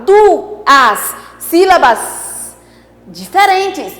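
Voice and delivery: "Duas sílabas" lento e separado; bem devagar. Shown as a demonstration. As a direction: aponta devagar para a turma. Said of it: "Olha lá, ó". (professora mostra as sílabas devagar)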